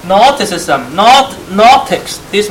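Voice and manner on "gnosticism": "Gnosticism" begun on N, no G sound; silent G. Shown as a demonstration. Teacher repeating the term for learners, "Gnosticism" with silent G. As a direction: pronounce "Gnosticism" as "nosticism". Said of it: "You don't pronounce the G".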